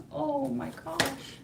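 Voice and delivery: ordinary talk, nothing marked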